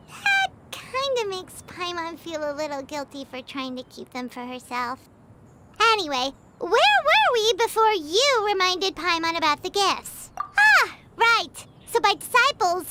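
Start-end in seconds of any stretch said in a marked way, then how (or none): 0.1-5.0 softer, trailing guilty mumble; guilty murmur. Acted. 10.4-11.7 sudden realization. Acted. Suddenly remembering.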